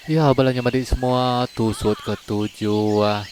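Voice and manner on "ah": ordinary delivery, nothing marked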